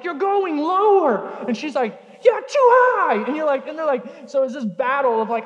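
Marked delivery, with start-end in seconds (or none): 0.0-1.3 high, loud, slow bewildered protest; confused adult pushing a swing. Retold or imitated. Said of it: "they would be so confused". 2.2-3.2 very high, loud, slow scream; screaming toddler on the swing; child screaming. Retold or imitated. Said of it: "she's screaming it".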